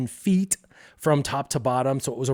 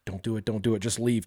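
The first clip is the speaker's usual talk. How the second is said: low, soft urgent self-talk; inner voice of caution; 4 semitones lower, 6 dB quieter than usual. Acted. His brain pleads with him at the hilltop, low and soft. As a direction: say it under your voice, lower and softer. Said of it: "my brain's going".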